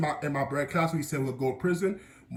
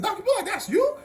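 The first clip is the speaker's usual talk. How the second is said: high-pitched incredulous question; retold amazement. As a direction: imitate a student's amazed double-take. Students react to teacher's old picture.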